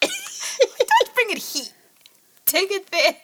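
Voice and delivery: mock telling off, laughing; laughing scold.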